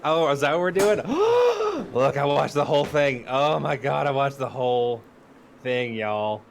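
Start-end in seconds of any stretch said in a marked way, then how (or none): 1.0-1.9 a high, loud, sharp gasp of mock shock; dramatic gasp. Real, not acted. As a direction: gasp like it's scandalous news. Reacting to the topic.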